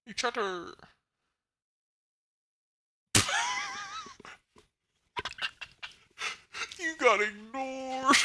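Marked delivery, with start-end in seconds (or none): 3.1-4.3 high laugh. Real, not acted. Laughs at chat being ignored.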